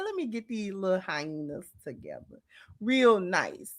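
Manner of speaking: gentle, hushed imitation; soft voice. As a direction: play her softly composed, taking them in hand. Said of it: "in that soft voice".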